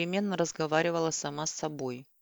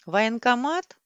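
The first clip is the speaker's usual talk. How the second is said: louder, higher, half deaf; loud question; 5 semitones higher, 6 dB louder, slower than usual. A narrator's reading. Hard-of-hearing old woman asks the caller, loudly. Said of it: "спрашивала очень громко".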